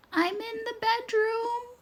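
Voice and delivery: wolf faking a frail grandma; wobbly fake grandma. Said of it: "in a strange, wobbly voice".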